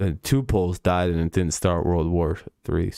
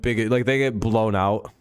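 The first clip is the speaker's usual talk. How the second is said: ordinary delivery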